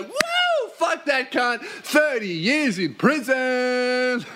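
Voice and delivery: high whoop into drawn-out crowing; mock gloating. Cop turns on the suspect after confessing, mock gloating cheer. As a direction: crow it with hammed-up glee. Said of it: "gets the confession and he's like".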